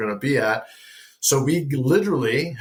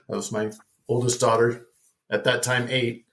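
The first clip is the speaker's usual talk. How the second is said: ordinary talk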